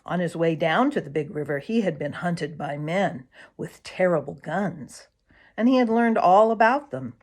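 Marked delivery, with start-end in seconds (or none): none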